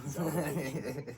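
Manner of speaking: slow laughing repeat. Real, not acted. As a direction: savor the pun, laughing.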